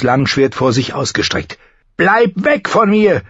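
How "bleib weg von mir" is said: high, drawn-out frightened order; shrill warning to keep away; shrill command. A narrator's reading. John warding off the walking corpse, shrill command. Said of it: "befahl er mit schriller Stimme".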